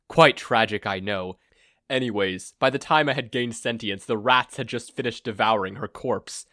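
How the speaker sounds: grandiose villain. Acted. Villain gloats to his blindfolded captive, pompous and loud. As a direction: boom out a self-important evil plan.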